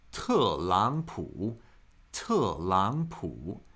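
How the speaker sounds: 特朗普 very slow, falling, rising, then dipping tone; teacher slowly modelling the tones of 特朗普; tone demonstration. Shown as a demonstration. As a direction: draw out each syllable of 特朗普 to show its tone. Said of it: "pronounced with a second tone".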